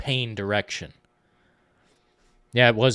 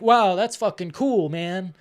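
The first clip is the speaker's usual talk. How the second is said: mock-thrilled kid voice, higher and louder; excited kid; 7 semitones higher, 5 dB louder, slower than usual. Kid gushing to the veteran, higher and louder. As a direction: play the dazzled kid gushing. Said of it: "the kid is like".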